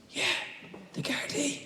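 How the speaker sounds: put-on high, hushed little-kid voice; a small child guarding the phone; child's voice. Child tells the caller his mother is busy, high and hushed.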